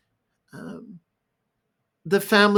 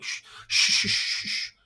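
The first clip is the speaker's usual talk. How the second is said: hushing "shh, shh"; shushing; 4 dB quieter than usual. Retold or imitated. Parent quickly hushing a sad child, shushing. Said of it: "hushed and shushed".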